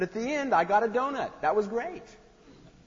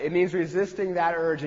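normal talking voice

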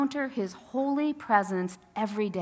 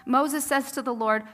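normal talking voice

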